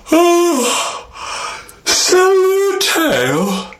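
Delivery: very high, slow, sleepy king voice; a lazy, put-upon sky king. Acted. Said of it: "Nwame said".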